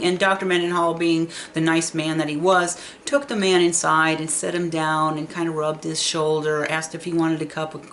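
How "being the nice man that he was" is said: usual speaking voice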